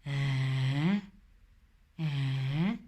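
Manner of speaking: "Eh?" low and questioning, drawn out; pidgin Eh. Shown as a demonstration. Teacher showing learners how to say "Eh?", low and questioning. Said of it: "you would say something like".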